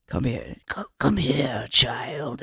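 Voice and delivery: put-on low, soft ominous elder calling the child; a hushed cult elder beckoning. Cult elder summoning the newcomer, low and hushed. Acted elder in dark bunker.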